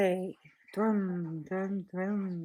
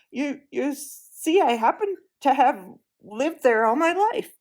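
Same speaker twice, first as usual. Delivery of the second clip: gruff Marsh-wiggle voice; 5 semitones higher, 6 dB louder than usual. Acted. Puddleglum voiced as character.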